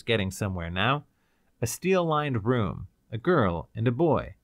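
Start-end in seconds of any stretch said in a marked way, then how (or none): none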